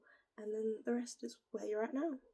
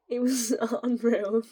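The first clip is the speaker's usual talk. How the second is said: loud laugh breaking through; 9 dB louder than usual. Real, not acted. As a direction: laugh out the last word. Laughing at eating potatoes.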